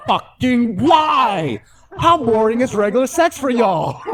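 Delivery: high, drawn-out "why" of disbelief; incredulous comic outburst; exasperated. Acted. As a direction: plead in hammed-up bafflement. Exasperated reaction to the kink story.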